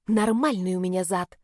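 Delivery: an offended hiss through the teeth; hissed retort. A narrator's reading. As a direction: hiss it back at him. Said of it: "прошипела". (шипит обиженно)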